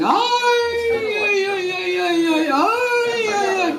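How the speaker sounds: imitating an old man's high wailing cry; mock wailing. Girl imitating the crying old man, mock wailing. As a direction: wail it high, half-acted. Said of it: "he was saying".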